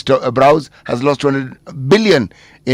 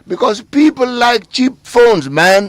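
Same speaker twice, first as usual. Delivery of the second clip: emphatic; 11 semitones higher, 4 dB louder, slower than usual. Real, not acted. Emphatic point on phone ban.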